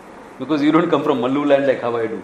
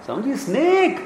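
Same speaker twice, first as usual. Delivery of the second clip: much higher, calling out in alarm; 9 semitones higher than usual. Acted. Scared walker calls for help, pitched high. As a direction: raise the alarm about a snake.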